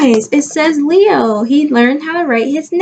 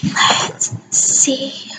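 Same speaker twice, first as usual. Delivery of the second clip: hushed secretive whisper before the page turn; 6 dB quieter, slower than usual. Acted. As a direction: draw the kids in, whispering. Teacher builds suspense before page turn.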